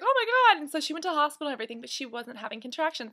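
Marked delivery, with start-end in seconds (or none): 0.0-0.6 high, louder startled "Oh my God"; surprised gasp. Retold or imitated. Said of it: "she was like".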